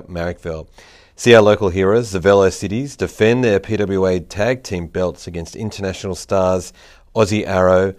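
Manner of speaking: upbeat announcer ad voice; punchy promo read, selling it. Acted. Sponsor ad read before the show.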